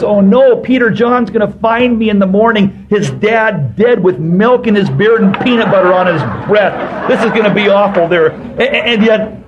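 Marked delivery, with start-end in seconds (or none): 0.0-8.3 high, loud mock panic; panicked thought. Preacher retelling his choking panic to the congregation, hammed-up and loud. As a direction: replay the midnight panic, louder and higher. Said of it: "all I could think about was".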